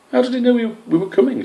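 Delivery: high eager little voice; a young squirrel asking; small squirrel voice. Acted. Squish's voice, high.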